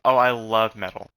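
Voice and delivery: loud mock enthusiasm; mocking, loudly voiced girl fan; imitated girl fan. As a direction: imitate her gushing, loudly. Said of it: "And she talks about".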